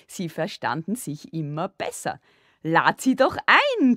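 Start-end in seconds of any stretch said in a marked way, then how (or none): 2.6-4.0 high, loud, drawn-out playful voice; gleeful, pitched up, teasing; giggling. A narrator's reading. Father urges Sissi to invite her, giggly glee. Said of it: "kicherte der Vater".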